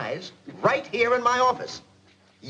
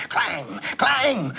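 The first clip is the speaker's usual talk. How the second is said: bell imitation; 6 semitones higher than usual. Imitating fire bells.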